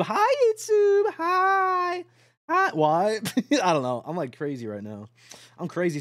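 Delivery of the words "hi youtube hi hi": very high, slow, drawn-out hi YouTube; squeaky high greeting. Shown as a demonstration. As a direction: stretch out the hello way up high, half crying.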